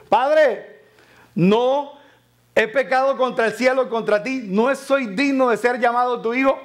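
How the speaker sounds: hijo pródigo rogando, más agudo y fuerte; pleading son. Acted. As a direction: suplica al padre con voz alzada. Repite la súplica del hijo. (hijo arrepentido que clama a su padre, voz aguda)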